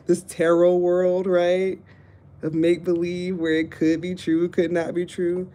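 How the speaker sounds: loud half-laughing scolding; loud laughing rant. Real, not acted. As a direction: call out the make-believe, laughing.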